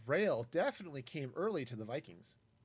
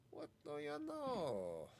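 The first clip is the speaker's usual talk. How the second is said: "What do you know?" drawn out slowly and higher; slow mock amazement; 5 semitones higher, 5 dB quieter, slower than usual. Repeats phrase, drawn out.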